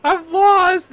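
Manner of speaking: high, loud cry of being lost; mock panic. Frightened girl alone at night, high loud panicked cry. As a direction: wail it like a frightened kid. Acting out scared lost character.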